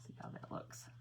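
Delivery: hushed whisper to herself; soft focused whisper. Real, not acted. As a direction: whisper it while you concentrate. Concentrating on drawing face.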